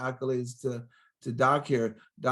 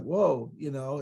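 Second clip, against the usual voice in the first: usual speaking voice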